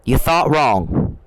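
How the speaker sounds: drawn out, slow, every word weighed; slow taunt. Real, not acted. To the candidate, a slow mocking put-down. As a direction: let it land slowly, mocking him. Taunting a politician he's angry at.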